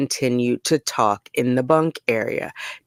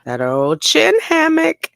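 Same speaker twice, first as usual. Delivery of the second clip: high, loud teasing jab; mocking aside; 13 semitones higher, 4 dB louder than usual. Real, not acted. Vlogger teasing the inmate's dangling mask, high mocking quip.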